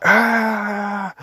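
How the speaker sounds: high, mock group war cheer; troops' cheer. Imitating the scouts cheering their commander, roaring. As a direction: cheer like a crowd of soldiers. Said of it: "all the scouts cheer".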